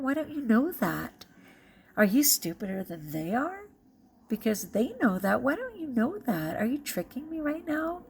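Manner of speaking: re-enacted disbelief, pitch climbing on each question; incredulous questioning. Replays her distrust of compliments.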